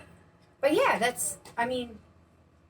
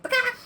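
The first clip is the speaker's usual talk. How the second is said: high squawked bakak; husband's bakak call. Retold or imitated. Wife imitating husband calling across Walmart. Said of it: "I would hear".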